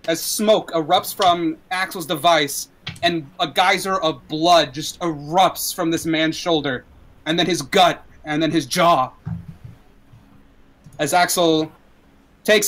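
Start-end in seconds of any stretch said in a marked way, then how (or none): none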